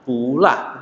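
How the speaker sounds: Pula with rising first syllable; second tone on pu. Shown as a demonstration. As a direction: lift pu from low, then drop la. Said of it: "Maka menjadi".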